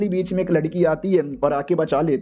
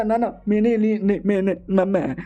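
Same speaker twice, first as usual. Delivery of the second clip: mock flustered stutter; stammering denial. A narrator's reading. Exposed villain denies it to angry girl, flustered stammer. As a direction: trip over your words, caught out.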